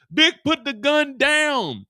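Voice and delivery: high, loud, slow cop order; police command. Acted. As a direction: order him slowly to drop it. Police officer commanding Vic.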